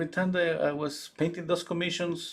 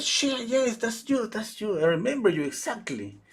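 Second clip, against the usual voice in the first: excited agreement; 10 semitones higher than usual. Retold or imitated. Friend agrees with the mother, thrilled. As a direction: cheer her on.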